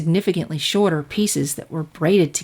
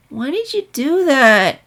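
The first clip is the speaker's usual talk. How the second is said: put-on lightly raised, slow child voice; earnest filly question; 6 semitones higher, slower than usual. Filly asks her teacher why, lightly high searching voice. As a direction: ask searchingly. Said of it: "she asked".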